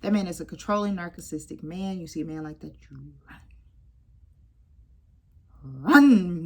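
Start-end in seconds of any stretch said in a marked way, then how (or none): 2.0-3.4 hushed warning whisper. Real, not acted. 5.6-6.4 loud urgent cry; loud mock command; shout. Shouted Lion King reference.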